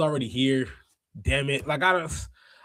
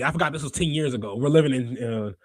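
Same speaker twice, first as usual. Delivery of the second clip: usual speaking voice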